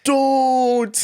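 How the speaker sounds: high hammed-up begging; mock pleading. Manager on his knees begging the customer, high mock plea. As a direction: beg on your knees, overacted. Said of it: "He's like".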